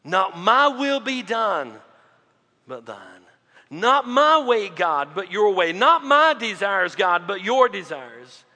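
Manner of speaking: slow, emphatic plea to God; prayer of surrender. Acted. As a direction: draw out each phrase, dropping on the yielding half. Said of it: "surrender to, to our will and say".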